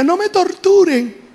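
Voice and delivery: high, slow mock plea; retold cry of pain. Patient begs therapists during painful therapy, retold pleading cry. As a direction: imitate crying out under torture, pitch lifted. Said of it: "yo gritaba, yo lloraba".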